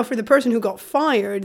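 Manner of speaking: ordinary talk, nothing marked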